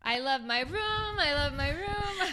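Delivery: put-on high, slow, whiny little-kid line; mock child whine. Voicing the child from the show, mock whining. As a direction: whimper like a kid about her room.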